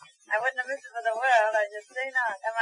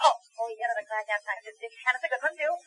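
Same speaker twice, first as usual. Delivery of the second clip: usual speaking voice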